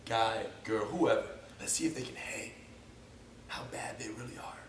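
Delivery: low murmur sinking into a whisper; hushed sizing-up. Acted. Existing students privately testing the new kid, hushed to whispered. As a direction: fade down to a secretive whisper.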